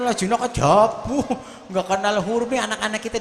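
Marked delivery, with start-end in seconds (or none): 0.1-1.3 Allah jinak ajabu mangled with sloppy Arabic sounds; a touch of a mock recitation; mock bad Quran reading. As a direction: imitate a careless reciter, lightly. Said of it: "baca Quran".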